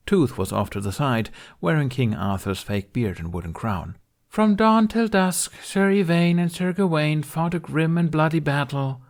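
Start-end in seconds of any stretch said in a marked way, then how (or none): 4.3-9.0 weary stage narrator. A narrator's reading. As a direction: recite it like a tired stage king. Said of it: "she repeated for the tenth time in defeat".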